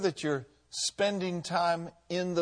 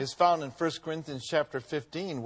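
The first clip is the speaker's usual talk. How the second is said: usual speaking voice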